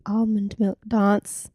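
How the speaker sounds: "Almond milk dance" slowly, long ah in dance; "Almond milk dance" with rounded, posh vowels, slow; South Australian pronunciation. Retold or imitated. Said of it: "South Australia and they say".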